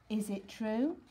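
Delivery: ordinary delivery, nothing marked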